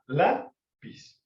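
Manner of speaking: "Lápiz" with LÁ rising sharply, then a separate piz; stress on first syllable. Shown as a demonstration. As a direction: hit LÁ in "Lápiz" and detach the piz. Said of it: "sí se acentúa". ("Lápiz" silabeado, acento marcado en LÁ)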